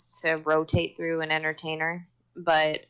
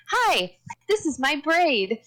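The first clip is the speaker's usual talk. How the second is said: put-on toddler voice; 9 semitones higher, 4 dB louder than usual. Toddler quoted greeting the waitress.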